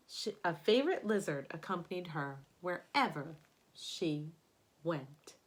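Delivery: expressive picture-book narration; storybook read-aloud voice; reading to children. Acted. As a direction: read it aloud to a class of kids. Reads picture book to class.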